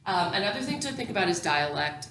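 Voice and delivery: ordinary talk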